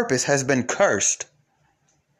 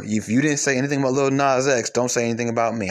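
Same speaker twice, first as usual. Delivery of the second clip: normal talking voice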